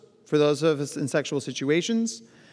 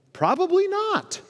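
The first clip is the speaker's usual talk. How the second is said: much higher, slow incredulous "probably not"; sarcastic squeak; slower than usual. Real, not acted. Speaker dismissing the rigid dater with a high, slow no. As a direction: stretch it up high in mock disbelief.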